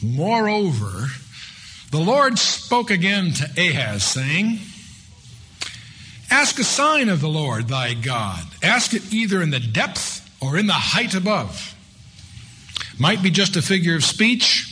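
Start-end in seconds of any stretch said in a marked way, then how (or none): 0.0-11.7 slow, raised, solemn reading of a Bible verse; scripture reading. Acted. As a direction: draw out each phrase of the verse slowly.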